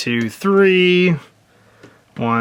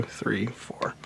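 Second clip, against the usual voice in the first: whispered counting; 7 semitones lower, 18 dB quieter than usual. Real, not acted. Counting the hero's moves to himself, whispered. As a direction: breathe the count out with no voice.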